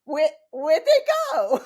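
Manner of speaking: high, baffled question breaking into a laugh; confused surprise. Retold or imitated. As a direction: act out the puzzled swimmer looking around. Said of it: "and you're like".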